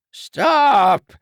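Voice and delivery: high, loud command; shouted stop. A narrator's reading. The doctor shouts at the dragon to stop. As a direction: yell it to halt the attack.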